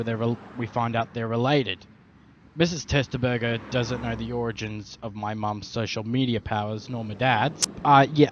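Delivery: usual speaking voice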